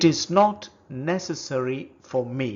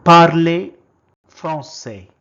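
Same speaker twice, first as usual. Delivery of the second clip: loud model phrase; 3 semitones higher, 15 dB louder than usual. Shown as a demonstration. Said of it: "is called".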